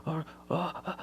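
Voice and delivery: whispered oh no, rising in panic; a scared father whispering over the fallen baby; frantic hushed oh no; panicked whisper. Retold or imitated. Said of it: "He goes".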